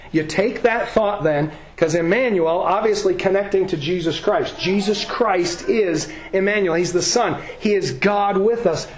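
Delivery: ordinary delivery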